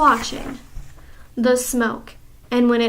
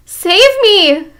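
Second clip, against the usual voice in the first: much higher, loud cry for help; desperate cry; 9 semitones higher, 10 dB louder than usual. Acted. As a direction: cry out in terror. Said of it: "cried the wretched witch".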